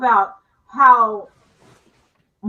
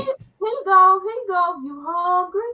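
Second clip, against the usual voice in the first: asking the pet if it's hungry, cooing; pet talk; 6 semitones higher, slower than usual. Real, not acted. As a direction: coo at the animal.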